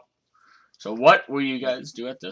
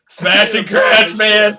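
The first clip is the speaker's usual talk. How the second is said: louder, drawn-out smash and crash; slow relishing repeat; 5 semitones higher, 7 dB louder, much slower than usual. Acted. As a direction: savor each word slowly. Savoring the phrase.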